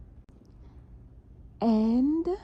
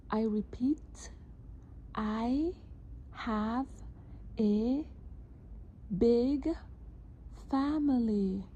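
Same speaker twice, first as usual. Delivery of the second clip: one word at a time, clearly; dictating; 5 semitones higher, much slower than usual. Shown as a demonstration. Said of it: "This dictation".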